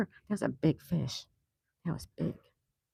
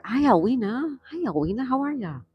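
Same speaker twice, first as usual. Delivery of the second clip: high, loud cheerful hello; excited greeting; 8 semitones higher, 7 dB louder than usual. Real, not acted. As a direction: call out the greeting brightly. Calling out greetings to chatter.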